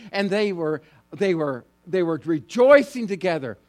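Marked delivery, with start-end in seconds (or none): none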